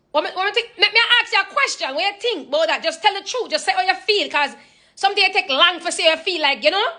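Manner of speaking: high Jamaican patois mom pressing for an answer; just tell the truth, Jamaican mother style; pushy Jamaican mom. Retold or imitated. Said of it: "she's already scaring the fuck out of me".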